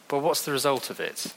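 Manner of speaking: normal talking voice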